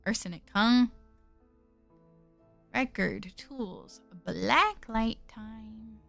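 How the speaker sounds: low, even narration voice; story narration. Acted. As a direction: read the game text calmly. Reads game narration quietly.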